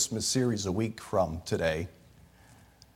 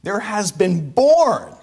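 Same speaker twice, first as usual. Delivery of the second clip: born drawn out slow, pitched higher and louder; slow, heavy emphasis landing on born; stress on born; 9 semitones higher, 5 dB louder, slower than usual. Shown as a demonstration. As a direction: lean hard on the word born. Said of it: "is the word born".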